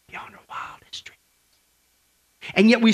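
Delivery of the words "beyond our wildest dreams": hushed whisper of wonder. Real, not acted. Pastor whispers the promise of heaven to the congregation. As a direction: breathe it out in awe.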